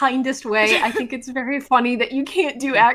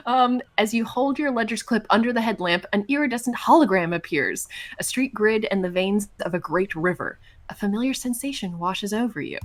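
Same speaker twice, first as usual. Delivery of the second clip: measured scene-setting, settling softer; game master narration; 3 semitones lower, 3 dB quieter than usual. A narrator's reading. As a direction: narrate a vision as it appears, evenly.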